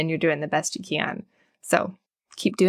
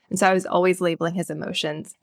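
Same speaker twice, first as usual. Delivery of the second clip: usual speaking voice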